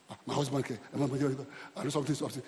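soft, low mumbling excuse; a wife's quiet dodge; sheepish reply. Retold or imitated. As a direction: mumble it evasively. Wife answers pastor, voiced.

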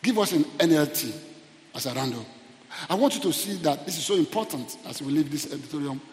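ordinary delivery

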